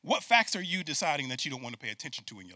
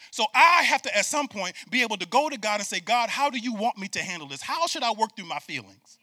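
loud, high preaching, driving the point; preaching at full force; 8 semitones higher, 7 dB louder than usual. Real, not acted. Pastor preaching hard to the congregation, loud and high.

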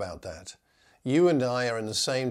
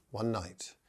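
"one night" repeated much softer, drawn in; softer echo; 2 semitones lower, 8 dB quieter than usual. Shown as a demonstration. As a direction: let the repeat drop to a hush. Repeated phrase for emphasis.

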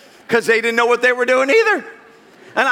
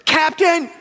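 yelled call; 6 semitones higher than usual. Retold or imitated. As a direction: shout for the captain from afar. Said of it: "yelling at me".

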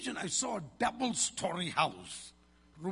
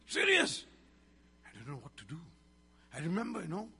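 normal talking voice

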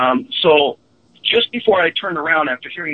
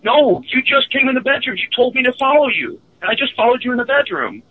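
higher, anxious insistence from a scared friend; frightened friend; 11 semitones higher than usual. Retold or imitated. Friend explains following the double into the bedroom, higher and frightened. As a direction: insist it, shaken, that he saw you. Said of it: "the look of terror on his face".

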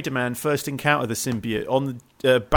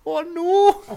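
put-on high little Geordie accent; 5 dB louder than usual. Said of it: "they always do little Geordie accents".

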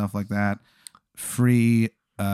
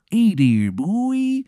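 slow, high Maryland drawl; hammed-up Maryland voice; Shithead Steve's Maryland accent; 8 semitones higher, much slower than usual. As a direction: stretch it out in a terrible Maryland accent. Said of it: "the accent is just".

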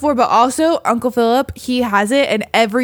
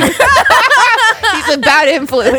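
laughing; 6 semitones higher, 6 dB louder, much slower than usual. Acted. Mock warning about Bubba.

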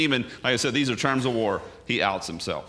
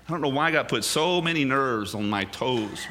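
ordinary talk

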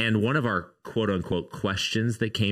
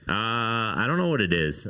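ordinary delivery

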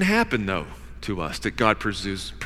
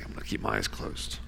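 whispered refusal; whispering a refusal to listen; stubborn whisper; 12 dB quieter than usual. Acted. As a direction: hush it like someone pretending to sleep.

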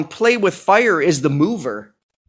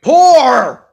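shouting; 7 semitones higher, 7 dB louder than usual. Pretend. Demanding beer from the intern.

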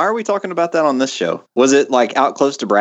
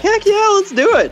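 excited. Retold or imitated. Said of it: "I was like".

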